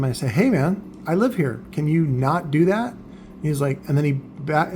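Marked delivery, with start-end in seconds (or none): none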